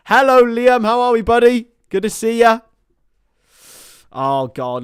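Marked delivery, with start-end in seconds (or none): 0.1-2.6 high, loud, hearty hello; calling out a greeting. Real, not acted. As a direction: greet a mate at full volume. Calls out greeting to arriving viewer.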